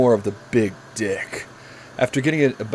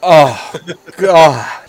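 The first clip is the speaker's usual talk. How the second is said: hammed-up groan; 5 semitones higher, 7 dB louder than usual. Voicing Barry's climax.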